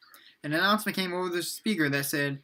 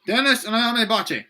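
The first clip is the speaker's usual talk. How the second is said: loud, higher roll-call voice; calling out names; 6 semitones higher, 6 dB louder than usual. A narrator's reading. Major Rio calls students up for uniforms, called out. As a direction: call the names across a classroom. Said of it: "he called out all the hu".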